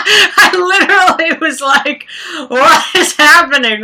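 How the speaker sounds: high, loud laugh spilling into the words; real laughter. Real, not acted. Recalling the song's twist, laughing out loud. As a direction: laugh hard and squeal. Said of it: "died laughing".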